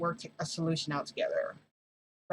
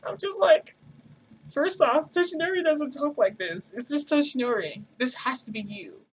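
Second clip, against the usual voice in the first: much higher and louder, amused disbelief; incredulous; 9 semitones higher, 7 dB louder than usual. Real, not acted. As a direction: still laughing, refuse to believe it. Incredulous rant about his speech.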